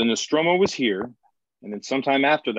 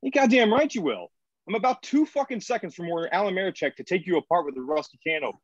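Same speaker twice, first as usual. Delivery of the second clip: angry threat; 9 semitones higher than usual. Acted. Lydecker threatens Thora.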